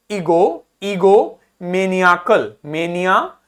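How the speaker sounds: slow pieces of ईगोमेनियाकल; teacher slowly splitting up ईगोमेनियाकल; word in parts. Shown as a demonstration. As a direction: break ईगोमेनियाकल into ईगो and mania. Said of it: "इस पे हमें जोर देना है".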